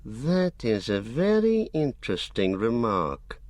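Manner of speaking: ordinary delivery, nothing marked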